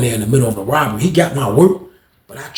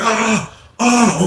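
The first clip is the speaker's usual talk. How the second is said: pained yell; 9 semitones higher than usual. Retold or imitated. Unc crying out in pain.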